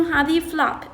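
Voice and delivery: ordinary delivery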